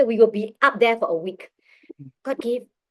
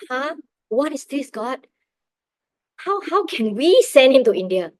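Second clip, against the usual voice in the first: reliving stunned disbelief, voice climbing higher and louder; shocked; 5 semitones higher, 7 dB louder than usual. Retold or imitated. Mother questions God over sending her son to India, shocked. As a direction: question it in mock-stunned shock, rising to a loud plea. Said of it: "I was in total shock".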